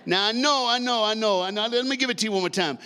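ordinary delivery

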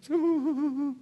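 high imitated infant whimper; heartbroken baby cry. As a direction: mimic a baby crying as if heartbroken. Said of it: "sounds like his heart's broken".

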